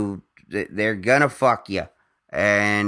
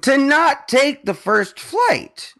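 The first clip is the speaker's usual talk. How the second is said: indignant outburst; 11 semitones higher than usual. Real, not acted. Indignant rant about airline fees.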